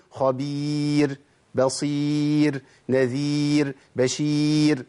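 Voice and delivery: "خبير، بصير، نذير، بشير" with long ي and light ر. Shown as a demonstration. Teacher gives students recited examples, "خبير، بصير، نذير، بشير" with light final ر. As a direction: hold each long ي evenly, ending on a thin ر. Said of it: "الراء ترقق عندي على طول".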